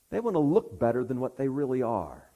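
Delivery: ordinary delivery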